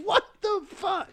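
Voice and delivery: high, slow shout, laughing through it; shocked yell. Pretend. Friend horrified by the creepy tongue hero, laughing shout. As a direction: yell it out in mock horror.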